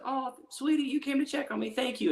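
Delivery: high, sugary thank-you; sweet thankful voice. Retold or imitated. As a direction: coo it sweetly at your wife.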